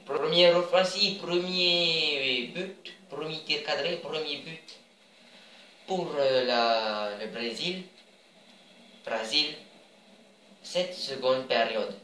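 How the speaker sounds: badly faked Brazilian accent, drawn-out words. Gamer announcing Brazil's first goal, mock Brazilian accent. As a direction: commentate slowly in an amateur Brazilian accent. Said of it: "C'était mon accent brésilien".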